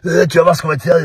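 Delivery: high, loud menacing warning; threat. Retold or imitated. Stranger threatens Ragnar from inside the car, loud and menacing. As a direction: promise trouble.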